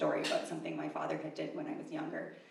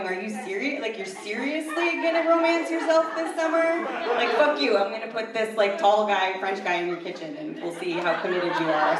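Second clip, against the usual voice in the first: loud, incredulous mocking challenge; taunting universe; 4 semitones higher, 8 dB louder than usual. The universe testing her vow to stay single, loud taunting disbelief. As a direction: call their bluff, pitched up and loud. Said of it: "like the universe is going".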